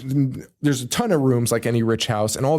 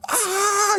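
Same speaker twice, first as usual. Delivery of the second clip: very high, held wail; high-pitched retold shriek; mock scream. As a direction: imitate someone screaming behind a door. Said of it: "still screaming at the top of his lungs".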